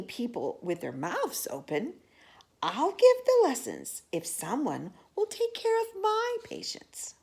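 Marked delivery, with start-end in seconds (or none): 2.6-7.1 dentist's high voice. Acted. Dentist's offer.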